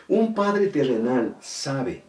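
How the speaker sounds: usual speaking voice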